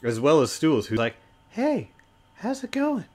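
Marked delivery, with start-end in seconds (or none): 1.5-3.1 tiny, very high-pitched mushroom creature; little telepathic voice. Acted. Little mushroom greets the party in their heads, tiny high voice. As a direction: squeak it out small and high. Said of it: "You hear this little voice in your head".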